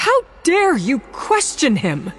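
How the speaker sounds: high, loud furious outburst; sharp, shrill. Acted. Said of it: "her voice sounded too sharp, too shrill".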